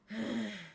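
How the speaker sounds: heavy, weary exhale; heaving breath. Acted. Player acting her character's adrenaline crash, heaving sighs. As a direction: breathe out hard, spent after the fight. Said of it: "heaving in her breath, just like".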